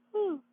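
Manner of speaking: a big high yawn breaking through. Real, not acted. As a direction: yawn mid-sentence, tired. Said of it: "sorry, I'm yawning".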